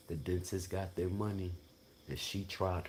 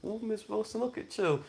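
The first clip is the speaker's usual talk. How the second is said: much higher mock dentist voice, playfully teasing; 4 dB louder than usual. Dentist teasing Miss Wilson about three men, in a high squeal. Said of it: "She was like".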